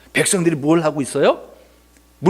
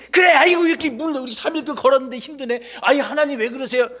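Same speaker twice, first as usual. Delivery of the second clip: acted weary grumble; 7 semitones higher than usual. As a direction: moan along with the grumblers in a raised pitch. Imagined Moses grumbling along with people. (힘들다고 높게 푸념)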